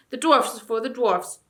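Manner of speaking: loud, defiant dwarf motto; a dwarf spits out the motto; sneering dwarf. A narrator's reading. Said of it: "sneered the dwarf".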